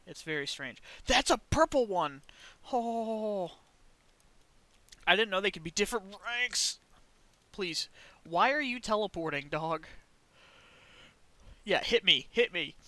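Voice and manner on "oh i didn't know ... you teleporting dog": ordinary delivery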